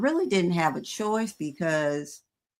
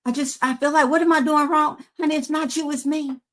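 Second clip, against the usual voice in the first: lightly voiced worried husband, higher; Gregory pleading; 7 semitones higher than usual. Husband asking what he's doing wrong, higher and stammering. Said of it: "Gregory's like".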